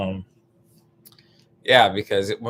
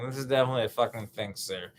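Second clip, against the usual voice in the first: ordinary talk, nothing marked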